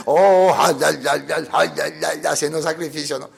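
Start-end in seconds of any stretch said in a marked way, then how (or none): 0.1-2.2 mock priest wailing. Preacher imitates the priest at the sacrifice, mock wailing. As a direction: ham up the priest's ecstatic cries. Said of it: "imagínense ya al sacerdote".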